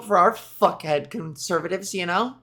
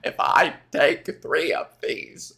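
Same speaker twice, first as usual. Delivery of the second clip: RFK-style voice again; 5 semitones lower than usual. Pretend. More RFK-style mocking voice.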